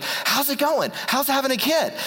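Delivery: high peppy friend checking in; happy and peppy. Retold or imitated. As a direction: chirp it like an upbeat buddy at the door. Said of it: "he's all happy and peppy".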